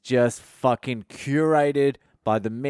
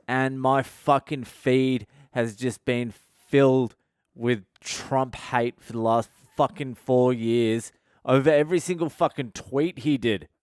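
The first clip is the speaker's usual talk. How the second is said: normal talking voice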